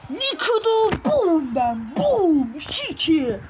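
loud high triumphant boom; goal celebration. Real, not acted. Playing football game, celebrating own goal: loud triumphant shout. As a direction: gloat over the goal, belting it out.

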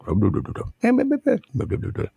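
distant murmur through the walls; muffled far-off talk. Retold or imitated. Overheard from the back of the house, muffled far-off mumble. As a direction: blah blah mumbled from the back room. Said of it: "you hear this conversation".

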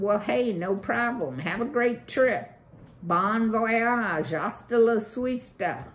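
loud, fast-talking swaggering beaver; brash beaver boss. Acted. Beaver boss brags to Valerian about his pond, brash loud pitch. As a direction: sell it like a pushy developer.

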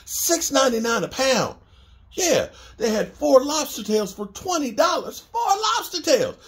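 high-pitched bargain excitement; delighted high disbelief; amazed at prices. Real, not acted. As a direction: marvel at the deal, voice climbing high.

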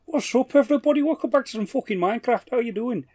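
high-pitched, put-on Scouse greeting; hammed-up Scouse impression; Scouse accent. Shown as a demonstration. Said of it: "I think that's Scouse".

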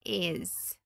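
"is" reduced to a small z; just a quick z for "is"; short z sound. Shown as a demonstration. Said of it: "um sonzinho de".

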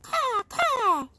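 high playful caw; crow caw. Shown as a demonstration. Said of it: "One, two, three".

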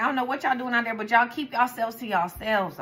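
usual speaking voice

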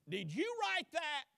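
loud, high accusing question; accusing demand. Retold or imitated. Church court grilling Luther, loud and accusing. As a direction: bark the charge at him.

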